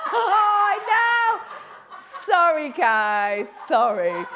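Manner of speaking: high, loud, giggling through the words; laughing apology. Real, not acted. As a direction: apologise while still cracking up. Laughing at her own sausage joke.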